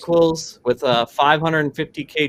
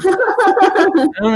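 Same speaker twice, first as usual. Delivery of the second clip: frustrated laugh in the voice; laughing while complaining; 5 dB louder than usual. Real, not acted. Laughing at broken audio.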